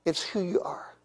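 unvoiced hushed line; whisper. Real, not acted. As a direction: breathe it softly.

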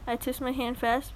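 ordinary talk, nothing marked

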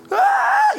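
high drawn-out "Ah!" cry; a sudden high wail as if collapsing; re-enacted wail. Preacher re-enacting his spiritual collapse, high crying wail.

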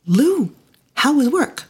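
high, sweet mock-innocent child voice; mischievous child asking; teasing kids. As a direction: ask like a sly little kid.

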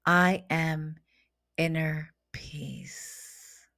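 very slow, spaced-out words with long pauses; affirmation recitation. Acted. Leading listeners in affirmations to repeat after her, slow meditative recitation. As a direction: intone each one deliberately, leaving room to repeat.

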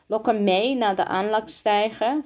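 plain reading voice

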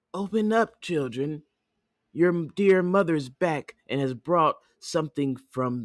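high, coaxing fake mother; put-on motherly wolf, starting high; wolf posing as mother. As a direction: lift the voice high to trick the kids.

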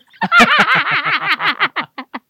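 a very loud, high howl of laughter. Real, not acted. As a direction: lose it completely, roaring with laughter. Laughing at the comparison.

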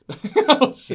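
high, loud burst of laughter. Real, not acted. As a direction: cracks up at the joke. Friend's silly belief mocked.

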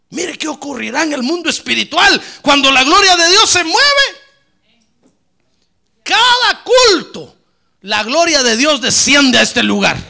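loud, high, drawn-out praise, slow on the peaks; fervent preaching shout. Acted. Pastor shouting praise before the congregation, loud and slow. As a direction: cry it out like a revival preacher. Preacher proclaims God's glory descending.

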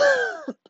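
loud high burst of laughter. Real, not acted. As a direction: cracks up mid-rant. Laughing at own rant.

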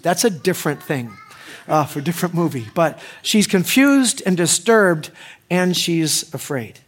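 usual speaking voice

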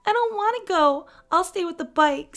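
put-on very high, loud child voice; little kid's refusal. Little brother refusing the hike, high loud whine. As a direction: dig in like a whining little boy.

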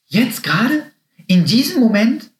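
high, rising, incredulous questions; astonished questioning. A narrator's reading. Happy learns the bunny is nearby now, astonished. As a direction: squeak it out in excited disbelief. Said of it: "Happy riss die Augen auf".

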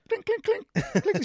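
very high-pitched repeated clinks; rapid high "clink" sound effect; imitated clinking. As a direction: squeak out little clinking noises. Imitated noise in the retelling.

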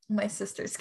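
ordinary talk, nothing marked

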